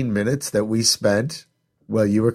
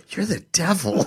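a higher, giggly accusation; accusing laughingly; 8 semitones higher than usual. Retold or imitated. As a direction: say it half laughing, teasing. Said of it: "She goes".

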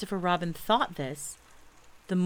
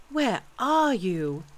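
higher, slow, drawn-out call; boy's anxious wail; worried cry; 5 semitones higher, 4 dB louder, much slower than usual. A narrator's reading. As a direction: call plaintively for a lost friend. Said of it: "cried Christopher Robin".

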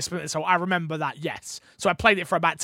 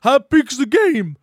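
loud mock complaint; Matt; 5 semitones higher, 9 dB louder than usual. Matt asking Fix about the download, loud mimicry. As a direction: questionable impression of a friend, pushed louder. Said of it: "These impressions are questionable".

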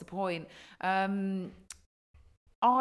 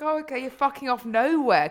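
loud, high sarcastic comeback; mocking retort; 8 semitones higher, 12 dB louder than usual. Answering partner stuck on the floor, loud mocking retort. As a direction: throw it back with amused scorn.

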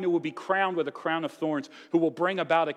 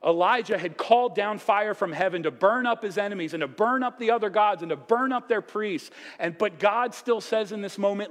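higher and louder, driving sermon build; fiery preaching; 7 semitones higher, 6 dB louder than usual. Acted. Preacher building to the climax against fire from heaven, full force.

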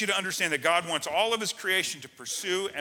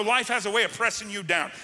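usual speaking voice

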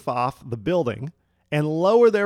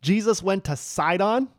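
shocked disbelief; 2 semitones higher, slower than usual. Pretend. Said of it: "we would say".

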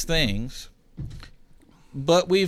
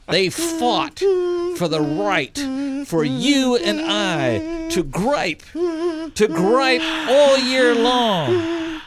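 rising, soaring, louder and higher to the end; patriotic speech; 11 semitones higher, 4 dB louder, slower than usual. Pretend. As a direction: build it like a tearful Fourth of July oration. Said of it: "Are you ready for the big speech?".

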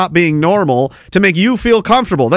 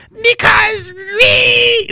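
mock whiny voice; much slower than usual. Mocking opponents' stammer.

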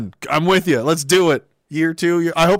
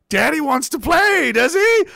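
put-on high, loud singsong baby talk; 12 semitones higher, 4 dB louder than usual. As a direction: coo like talking to a toddler. Baby talk to excited person.

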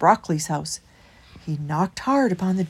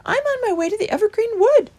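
high, raised mouse voice; little mouse; 14 semitones higher, 7 dB louder than usual. A narrator's reading. Christopher telling Holgate his destination, high and piping. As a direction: pipe up in a high voice.

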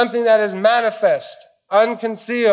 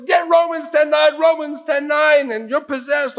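mocking know-it-all; 7 semitones higher than usual. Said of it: "We were so wise".

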